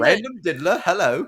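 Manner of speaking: mocking the two Brits with a silly greeting. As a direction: play a chipper Brit, tongue in cheek. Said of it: "they're just like".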